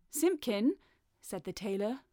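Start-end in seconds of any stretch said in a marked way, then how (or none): 0.1-0.8 high-pitched summons to the cat; insistent call. A narrator's reading. Tailor summoning Simpkin the cat, insistent call.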